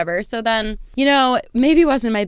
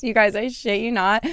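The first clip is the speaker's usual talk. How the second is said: ordinary delivery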